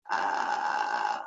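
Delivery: low creaky fry sound; vocal fry. Shown as a demonstration. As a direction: crackle gently in fry. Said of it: "try the sound with me".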